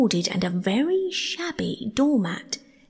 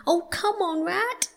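high, pleading mole voice; impatient mole; 11 semitones higher, slower than usual. A narrator's reading. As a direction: urge a friend on impatiently. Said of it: "the mole waited impatiently".

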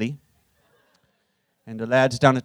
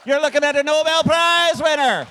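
triumphant boast; 13 semitones higher than usual. Acted. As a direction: proclaim it to the room in a high, sustained cry. Crows after reciting his poem.

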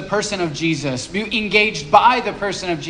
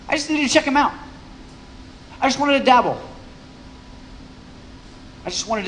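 higher, lightly mocking Zacchaeus voice; a noncommittal seeker, voice raised; voicing Zacchaeus; 7 semitones higher, 4 dB quieter, much faster than usual. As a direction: play him as a half-hearted dabbler, pitched up.

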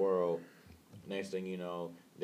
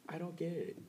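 put-on high, soft little old lady voice; sweet old woman voice; 8 semitones higher, 8 dB quieter than usual. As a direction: play a sweet old lady, gently puzzled. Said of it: "sweet, like, old woman voice".

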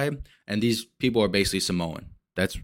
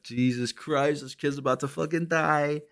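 retold dread; 6 semitones higher, 4 dB quieter than usual. Viewer reliving the kid's close call, retold dread. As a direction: stretch it out high, dreading what comes next.

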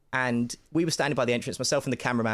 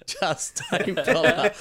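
slow, laughing dismissal, pitched up; 7 semitones higher, 4 dB louder, much slower than usual. Real, not acted. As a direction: laugh it out slowly. Repeats while laughing.

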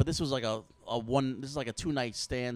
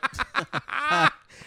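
sudden high laughing burst; 6 dB louder than usual. Real, not acted. Laughing mid-story.

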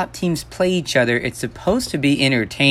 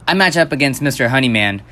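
usual speaking voice

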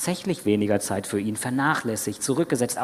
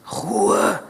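higher-pitched brusque hush; ordre bourru; 6 semitones higher than usual. A narrator's reading. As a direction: order silence gruffly, without shouting. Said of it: "befahl dieser Unwirsch".

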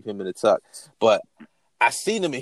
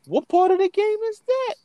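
much higher, incredulous outrage; outraged disbelief. Retold or imitated. Smelling crack in the blunt, incredulous. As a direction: pitch it up in disbelief.

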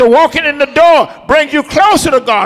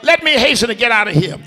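ordinary delivery

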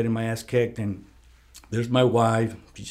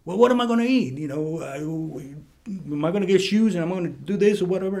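high-pitched whining child imitation; complaining kid; 7 semitones higher than usual. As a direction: mimic a fretting child, voice jumping up. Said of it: "one of my kids kept complaining to me".

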